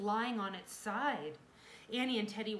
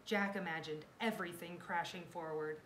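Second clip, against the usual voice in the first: ordinary delivery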